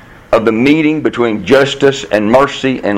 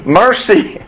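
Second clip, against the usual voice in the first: called-out "Mercy", higher and drawn out with a falling end; a second, higher call of "Mercy" from the pit; 7 semitones higher than usual. Retold or imitated. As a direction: call "Mercy" as if from far away. A boy calls Mercy's name.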